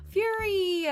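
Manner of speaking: high, loud surprised cry; excited shout. Real, not acted. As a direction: yelp the name in delight. Excited gasp at gift.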